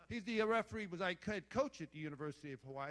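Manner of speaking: usual speaking voice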